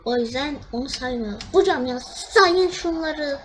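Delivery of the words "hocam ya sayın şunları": slow, louder pleading whine; exasperated plea; pleading complaint. Real, not acted. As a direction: beg the ref to count it. Begging the ref to count.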